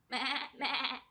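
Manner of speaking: mock goat bleating. Librarian shows the children the goat sound, bleating. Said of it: "it makes a sound like this".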